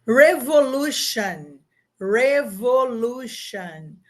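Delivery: "Revolution" said slowly, English "-shon" ending; slow English demo. Shown as a demonstration. Teacher models the English word, slow and clear. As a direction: draw out "Revolution" for the class. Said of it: "como que fica em português".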